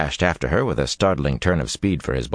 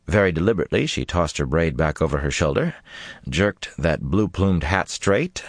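usual speaking voice

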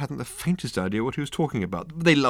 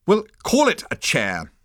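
higher-pitched irritated retort; testy; 8 semitones higher, 4 dB louder than usual. A narrator's reading. Management consultant corrects Ford, testy. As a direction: concede nothing, testily. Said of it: "he said testily".

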